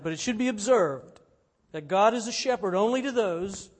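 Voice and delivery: forceful sermon reading. Real, not acted. Pastor reading Calvin to the congregation, forceful preaching.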